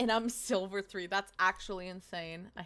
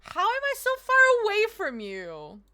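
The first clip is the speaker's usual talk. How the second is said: dismayed; 11 semitones higher, 9 dB louder than usual. Real, not acted. Dismayed exclaim.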